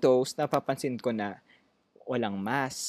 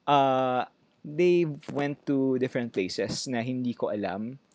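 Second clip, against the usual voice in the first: ordinary talk, nothing marked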